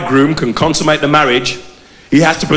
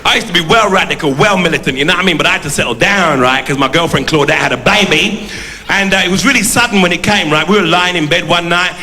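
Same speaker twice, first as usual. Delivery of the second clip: Brixton geezer character; 4 semitones higher than usual. Acted. Devil Wilkins addressing the audience, in a brash Brixton character voice.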